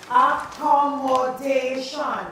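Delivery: accommodation said slowly and clearly; slow dictation. Shown as a demonstration. Teacher dictating accommodation to the class slowly. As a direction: stretch accommodation out.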